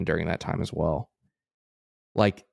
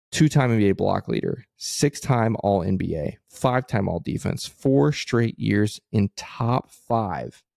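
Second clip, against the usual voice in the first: slow, deliberate, clearly spaced list. Shown as a demonstration. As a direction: spell each item out carefully. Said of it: "to clarify that last section especially".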